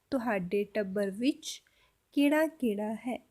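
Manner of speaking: slowly and clearly, for learners; slow repeat. Shown as a demonstration. Teacher slowly repeating the Punjabi question for students. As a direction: spell the sentence out slowly. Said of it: "तो पूरा sentence कैसे बोलेंगे".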